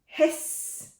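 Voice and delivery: voiceless goose hiss. Acted. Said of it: "said the goose".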